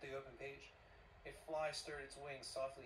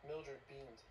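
usual speaking voice

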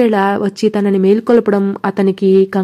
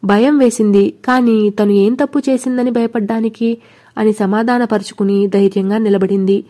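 ordinary delivery

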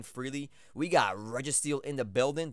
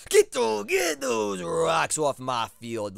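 high, loud, slowly stretched yell; pleading yell; 6 semitones higher, 8 dB louder, much slower than usual. Real, not acted. Player begging his Togetic to clear the rocks, loud and slow. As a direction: drag out every word like a begging chant. Urging his Pokemon to defog.

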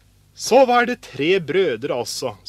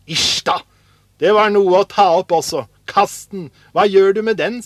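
loud, higher-pitched scorn; disgusted scolding; 4 semitones higher, 8 dB louder than usual. A narrator's reading. Brothers reject the old shoe sole, disgusted. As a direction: tell him off in disgust.

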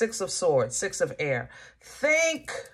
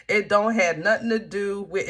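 usual speaking voice

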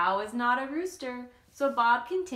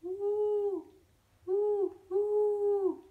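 high hooting owl calls; 10 semitones higher, much slower than usual. Acted. Stranger owl calling out to Bob in the dark. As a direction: hoot like an owl in the night. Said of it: "a stranger called out".

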